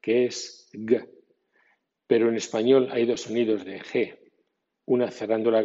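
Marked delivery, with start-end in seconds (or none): 0.7-1.1 "G" said slow with a hard stop in the throat; slow hard English G; closed-throat G. Shown as a demonstration. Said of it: "solo existe esa G".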